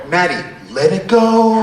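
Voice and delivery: a hint of singing the Frozen line; mock tender advice. Comic consoling the crying girl, slow and mock tender. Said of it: "I put my hand on her shoulder".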